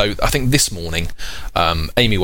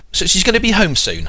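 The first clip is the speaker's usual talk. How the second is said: put-on child asking; 10 semitones higher than usual. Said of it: "And she says".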